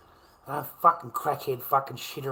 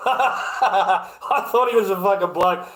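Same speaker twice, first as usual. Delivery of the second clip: slow, laughing through the words; 4 semitones higher, 4 dB louder, slower than usual. Real, not acted. Streamer to chat, repeating the mix-up through real laughter. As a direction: crack up while repeating it.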